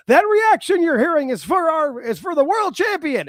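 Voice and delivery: high, loud, hyped-up commentator call; Shivani impression. Podcaster mimics commentator hyping the champion's entrance, excited mock call. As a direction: sell it big like a wrestling announcer. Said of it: "Shivani goes".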